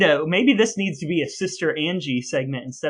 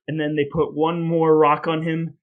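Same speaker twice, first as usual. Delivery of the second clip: ordinary talk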